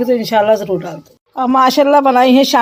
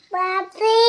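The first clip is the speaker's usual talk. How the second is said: calling out; 8 semitones higher, 7 dB quieter, much slower than usual. Real, not acted. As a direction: call out in a high, rising voice. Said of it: "ज़ोर से आवाज़ दो". (ऊँचे सुर में पुकारना)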